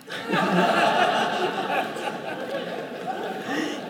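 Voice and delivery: a high, open burst of laughter at the punchline; real, delighted laughter; laughing. Real, not acted.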